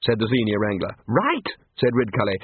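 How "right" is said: higher, rising, interested "right"; changed tone. A narrator's reading. Ridcully hears of big fish, newly interested. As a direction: perk up, inviting more. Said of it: "significant change in his tone".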